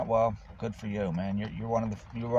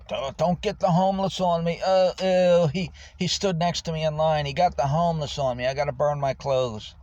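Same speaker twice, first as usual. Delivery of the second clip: mocking snob; 6 semitones higher, 3 dB louder than usual. Mocking people who shun homeless.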